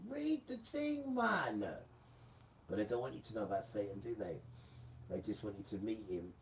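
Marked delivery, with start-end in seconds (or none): 0.1-1.6 much higher, slow stretched call; high drawn-out urging. Real, not acted. Telling listeners to read the book, high and slow. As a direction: stretch it out high, egging them on.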